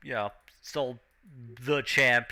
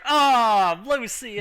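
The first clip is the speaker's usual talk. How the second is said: high, drawn-out crowd groan; acted crowd lament; disappointed crowd; 8 semitones higher, 6 dB louder, much slower than usual. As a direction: moan the name like let-down fans. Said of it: "the crowd just like".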